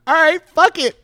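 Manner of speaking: loud, high comic surrender; reckless giving in. Retold or imitated. Comic agreeing to drive home, loudly reckless. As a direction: throw caution away at full voice. Gives in, laughing through it.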